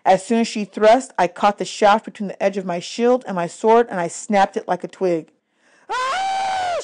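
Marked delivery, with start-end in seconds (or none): 5.9-6.8 high piercing shriek of rage; furious scream. Acted. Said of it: "she screamed".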